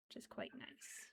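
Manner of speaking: a soft whispered aside to herself; quiet whisper. Real, not acted. Quiet aside about own work.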